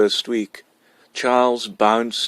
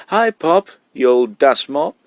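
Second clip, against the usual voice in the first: put-on cheeky boy; 5 semitones higher, 6 dB louder than usual. A narrator's reading. Cheeky boy greets father.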